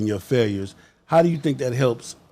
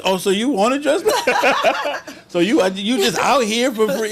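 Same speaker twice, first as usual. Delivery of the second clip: mocking movie quote, laughing; 10 semitones higher than usual. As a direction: replay the scene, cracking up. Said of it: "that part where you say".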